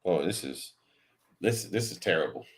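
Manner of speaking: usual speaking voice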